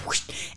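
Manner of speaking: soft, airy whoosh; whoosh sound effect. Retold or imitated. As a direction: imitate a quick swing through air. Imitates the slap.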